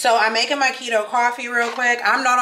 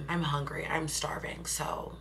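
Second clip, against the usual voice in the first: whispering; 4 semitones lower, 12 dB quieter than usual. Real, not acted. Quiet aside about hunger.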